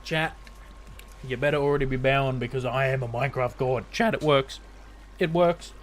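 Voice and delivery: loud, thrilled announcement; excited. Real, not acted. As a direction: burst out with delight. Farm works, excited gloating.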